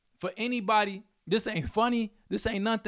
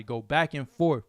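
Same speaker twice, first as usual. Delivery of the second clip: ordinary talk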